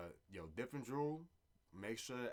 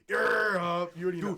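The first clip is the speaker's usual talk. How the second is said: playful call-out; 9 semitones higher, 16 dB louder than usual. Pretend. New Yorker planning to call out across Europe, playful loud holler.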